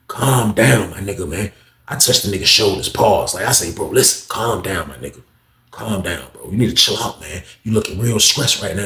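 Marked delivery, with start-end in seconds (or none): 0.1-1.5 low, settling-down voice; acted calming. Friend soothing an upset inmate, calming. 3.7-8.8 low, soft reassurance; acted calming. Friend telling a stressed inmate to chill, calming. Teller soothing an upset inmate.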